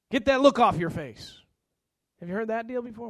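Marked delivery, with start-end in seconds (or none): 0.1-1.4 loud parental bark; scolding parent. Acted. Parent to a child about that look, loud scolding. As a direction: snap it out like a fed-up parent.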